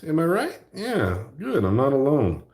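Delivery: ordinary talk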